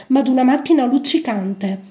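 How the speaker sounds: usual speaking voice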